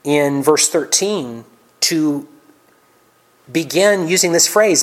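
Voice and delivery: ordinary delivery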